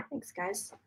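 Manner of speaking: soft, spent whisper; whispered thanks. Real, not acted. Medium thanking her viewers, whispering softly. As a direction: whisper it out, worn through.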